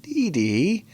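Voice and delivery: high, pleading, reassuring voice; gently reassuring Dee Dee in a high voice; tender plea. Retold or imitated. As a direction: soothe her like a friend. Talking to character, gushing.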